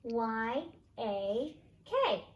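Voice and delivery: slow, letter by letter; spelling slowly. Shown as a demonstration. Teacher spells story words, slow and clear. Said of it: "teacher spells word slowly".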